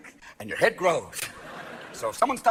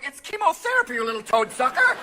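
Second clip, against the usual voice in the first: indignant retort; 8 semitones higher, 5 dB louder than usual. Retold or imitated. Defending Lance Armstrong.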